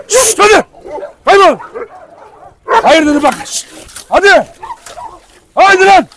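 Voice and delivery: frantic high yells to split up a dog fight; shouting at dogs. Real, not acted. Owner yelling at his fighting dogs, frantic shouts. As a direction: bark sharp commands at fighting dogs.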